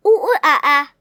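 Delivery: playful ooh ooh ah ah; monkey noises. Acted. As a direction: hoot like a monkey for a child. Imitating monkey.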